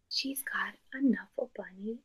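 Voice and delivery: hushed shocked whisper. Real, not acted. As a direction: whisper it like a big secret. Teacher whispers discovery to class.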